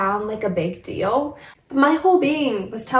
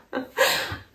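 quiet breathy tearful sob; a soft choked cry; real stifled sob; stifled sob-laugh; 6 dB quieter than usual. Real, not acted. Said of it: "I'm trying not to be emotional".

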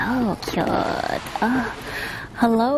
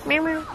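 playful meow; 7 semitones higher than usual. Pretend. Meowing at cats.

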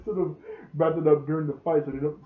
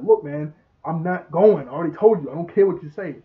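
angry refusal; 2 semitones higher, 8 dB louder than usual. Retold or imitated. Orc angrily refusing Jeremy.

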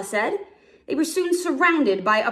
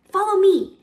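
calling out; 10 semitones higher, 7 dB louder than usual. Acted. Nala calls Simba along.

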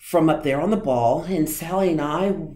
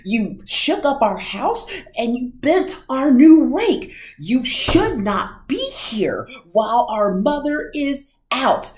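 high, loud scolding fish; 7 semitones higher, 5 dB louder than usual. A narrator's reading. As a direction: fish in a huffy tirade. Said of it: "said the fish in the pot".

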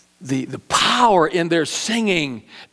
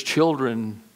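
ordinary talk

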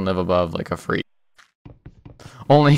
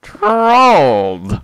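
loud, high gotcha; gloating; 5 semitones higher, 5 dB louder than usual. Real, not acted. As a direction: crow it like you won. Gloating after a prank.

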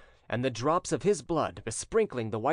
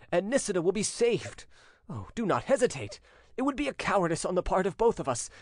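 higher-pitched, impassioned pleading, building in urgency; persuasive entreaty; 7 semitones higher than usual. A narrator's reading. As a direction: implore your father, from confession to urgent appeal. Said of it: "with an irresistible accent of persuasion and entreaty".

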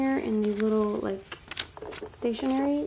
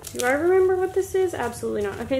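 normal talking voice

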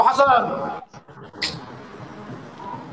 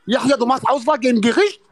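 ordinary talk, nothing marked

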